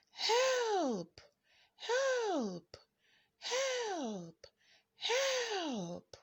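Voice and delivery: high calls fading from the cave; repeated cries for help. A narrator's reading. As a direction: keep crying out, each call a little weaker. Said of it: "she yelled".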